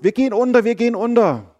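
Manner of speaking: high, loud panic; panicked cry. Acted. Disciples panic in the storm. (die Jünger schreien in Panik, viel höher und lauter)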